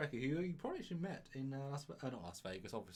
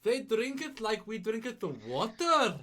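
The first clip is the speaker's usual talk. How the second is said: a hammed-up Scottish brogue, higher and louder; mock Scottish accent; 5 semitones higher, 5 dB louder than usual. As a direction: play a cheeky Scotsman teasing his mate. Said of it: "say it in a Scottish accent".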